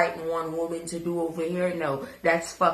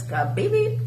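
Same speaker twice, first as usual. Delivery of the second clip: bleep noise; 12 semitones higher than usual. Pretend.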